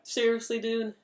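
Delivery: high, exasperated disbelief; indignant high-pitched complaint, acted out; mock indignation. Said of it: "I was just mad".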